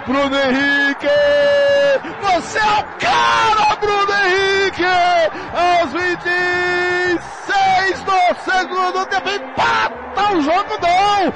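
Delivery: shouted excited play-by-play; explosive goal commentary. Real, not acted. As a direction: celebrate the goal at full voice. Goal call continues.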